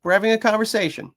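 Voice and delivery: high, lilting okay to a dog; accepting response. Retold or imitated. Trainer answering dog's objection, high accepting lilt. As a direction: answer brightly, voice lifted high. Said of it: "then I go".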